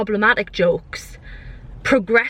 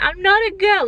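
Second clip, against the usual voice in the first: higher, indignant denial; offended protest; 9 semitones higher than usual. Retold or imitated. Men in the panto insisting they're not girls, indignant. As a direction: push it away like an insult. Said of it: "as if it was a bad thing".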